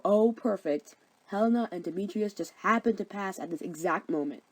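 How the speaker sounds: normal talking voice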